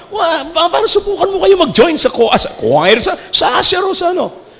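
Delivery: usual speaking voice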